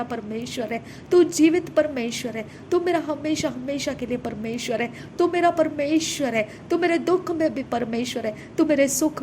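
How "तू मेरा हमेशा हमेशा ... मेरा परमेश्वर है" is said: normal talking voice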